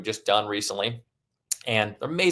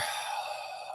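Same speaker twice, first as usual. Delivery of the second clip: slow audible exhale letting the anger go; long release sigh; 13 dB quieter than usual. Shown as a demonstration. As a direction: breathe it all out in one sigh. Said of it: "I'm gonna breathe".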